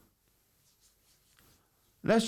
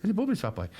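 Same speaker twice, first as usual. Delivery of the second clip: put-on higher cheeky Irish traveller accent; 10 semitones higher, much faster than usual. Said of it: "lovely word in a traveller accent".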